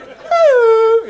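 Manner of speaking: loud high whoop of joy; a big excited woo; joyful woo. Pretend. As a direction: whoop like you're leaping. Jumping, yell.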